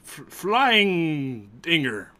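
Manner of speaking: very slow, drawn-out naming; slow reveal. Acted. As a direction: stretch out the name as you reveal it. Sea elf naming his pet turtle.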